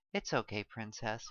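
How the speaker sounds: soft, low tender reassurance; calm a small child; gentle soothing. A narrator's reading. Father soothing daughter.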